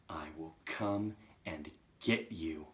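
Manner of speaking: slow, restrained, measured menace; ominous threat. Acted. Chalk threatening Agatha, slow and restrained. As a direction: promise it gravely, word by word.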